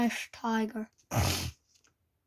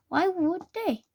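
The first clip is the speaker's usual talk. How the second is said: ordinary delivery, nothing marked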